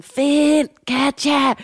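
long, drawn-out frightened yell; slow scared shout for Katja. Retold or imitated. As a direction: call for help, stretching every sound. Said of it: "begynder at råbe sådan".

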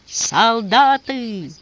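slow booming call to the troops; thunderous shout. A narrator's reading. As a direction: bellow it out, long and heavy. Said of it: "и прогремел". (протяжный громовой окрик)

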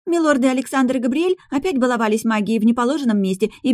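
put-on high, breathless young maid. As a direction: rush it out like a flustered maid. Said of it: "запыхавшаяся Лара".

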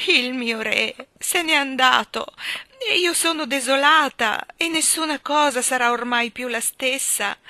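high, tearful wailing; queen mourning her vanished husband, lamenting; weak-voiced lament. A narrator's reading. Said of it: "ella si lamentava con voce debole".

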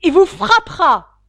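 yelling. A narrator's reading. Young suitor screams divine punishment at the father. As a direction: scream the curse at full force. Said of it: "hurla le jeune Drebber".

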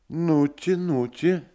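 high coaxing. Acted. Doctor urging him on.

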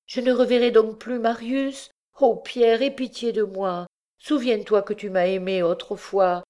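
desperate pleading mother. Acted.

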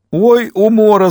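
high, loud, gleeful crowing; hilarious glee. A narrator's reading. Joker mocking a comrade who shot an idol, gleeful. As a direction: crow it as if helpless with mirth. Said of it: "заливался Лис".

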